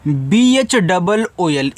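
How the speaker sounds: letters spelled out one by one, slowly; slow letter-by-letter spelling. Shown as a demonstration. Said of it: "इसको पढ़ते हैं भूल".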